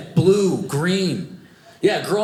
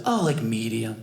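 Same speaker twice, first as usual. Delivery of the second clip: offhand shelter worker; 5 semitones lower, 3 dB quieter than usual. Retold or imitated. Shelter staff's offhand answer.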